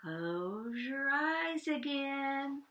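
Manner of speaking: very slow, gentle and high; slow and soothing. Acted. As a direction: ease them into it slowly. Frederick soothes the mice.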